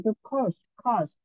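"cost" said clearly, then repeated alone; model pronunciation. Shown as a demonstration. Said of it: "câu mười lăm nó sẽ là gì". (đọc mẫu rõ chữ "cost" rồi nhắc lại)

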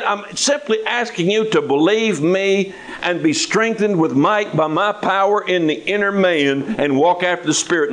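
normal talking voice

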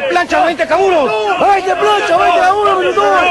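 shouted reply. Real, not acted. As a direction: yell it at attention. Cadet shouting back punishment.

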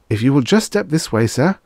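high, brisk, obliging invitation; eager. A narrator's reading. Clerk ushering customer to the adjustment, eager. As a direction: usher him along quickly, pitch up. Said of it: "the clerk said eagerly".

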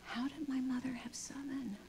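hushed, unvoiced wonder; whispered thought. A mother thinking of her own mother, whispered. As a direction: whisper it in amazement. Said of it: "And then I thought".

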